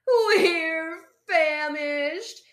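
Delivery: slow, high, loud wail; howling. A narrator's reading. Hungry foxes to Fosdyke, howling. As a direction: howl it, stretching each word. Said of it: "they howled".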